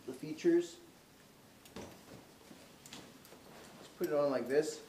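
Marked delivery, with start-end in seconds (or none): none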